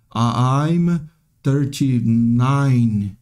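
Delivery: mimicking a learner's very slow, stilted English; robotic. Teacher shows how learners answer about age, robotic and laboured. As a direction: drag out "thirty-nine" syllable by syllable, stiff and mechanical. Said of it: "Sai meio robótico".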